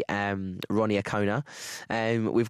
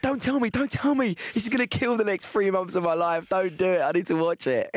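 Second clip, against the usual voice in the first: pleading; 7 semitones higher than usual. Retold or imitated. Acting out begging to avoid spoilers.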